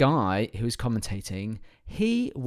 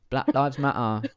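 British accent. Shown as a demonstration. British host demonstrating the slogan, slow British accent. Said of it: "because they're going".

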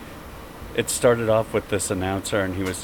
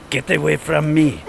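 Russian supermodel; 9 semitones higher, 7 dB louder than usual. Retold or imitated. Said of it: "Candace goes".

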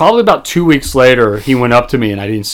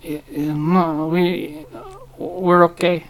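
hushed, hesitant mock customer reply; halting and very soft, imitating a customer; wary customer; 2 semitones higher, 9 dB quieter, slower than usual. Startled lady politely declines help from staff, hushed and hesitant.

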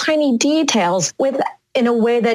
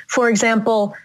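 normal talking voice

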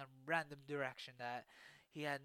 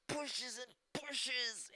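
slow, strained and higher; straining effort; 8 semitones higher, 5 dB louder, much slower than usual. A narrator's reading. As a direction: drag the words out as if pushing with all your weight. Straining to push door.

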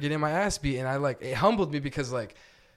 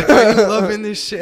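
much higher, louder gleeful jeer; gleeful taunt; 7 semitones higher, 12 dB louder than usual. Retold or imitated. Retelling the fighter gloating mid-fight, high and loud. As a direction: gloat like you're enjoying the beating.

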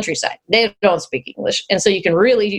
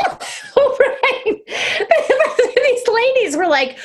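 very high, squeaky with laughter; words swallowed up by giggles; helpless laughter; slower than usual. Real, not acted. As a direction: gasp it out through real laughing. Laughing at her own mistake.

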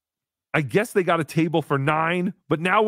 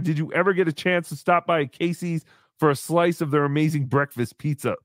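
ordinary delivery

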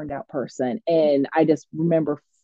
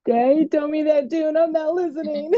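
high, laughing protest; high-pitched laughing refusal; laughing defiance; 8 semitones higher than usual. Retold or imitated. Defiant reply to supervisor, crying laugh.

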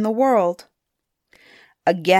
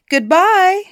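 high, loud farewell call; cheerful loud goodbye; called back; 11 semitones higher, 6 dB louder than usual. A narrator's reading. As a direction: call it back over your shoulder. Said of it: "he called back".

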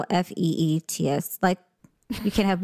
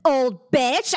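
mock kid fury; 7 semitones higher, much slower than usual. Retold childish fury at teacher.

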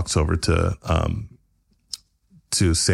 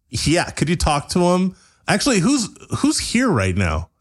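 high character voice; 9 semitones higher than usual. Acted. Sam voiced, pitched up.

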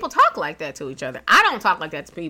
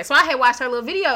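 usual speaking voice

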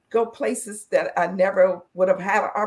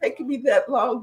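higher, laughing through the words; 9 semitones higher, 5 dB louder than usual. Real, not acted. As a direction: laugh at your own struggle. Laughing about the long thesis.

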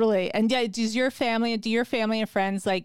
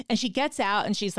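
ordinary delivery

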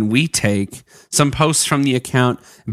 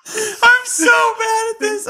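laughing. Real, not acted. Laughing while trying to read.

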